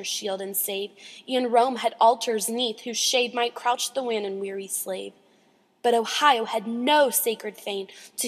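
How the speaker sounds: measured, emphatic poetry reading; dramatic poem recitation. Acted. Reader reciting The Slave Mother, solemn dramatic recitation. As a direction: declaim the verse line by line, with weight.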